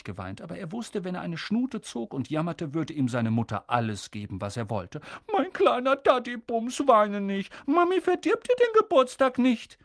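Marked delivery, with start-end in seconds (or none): 5.3-9.8 much higher doting coo; higher, baby-talk mother. A narrator's reading. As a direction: soothe the spoiled boy. Mother cooing to crying son.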